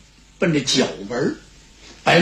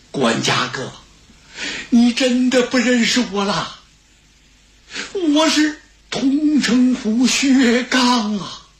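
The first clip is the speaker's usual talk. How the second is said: emotional Xue Gang; 6 semitones higher, slower than usual. Acted. Returning fugitive to the old steward who forgot him, pleading. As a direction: slowly reveal yourself to an old friend.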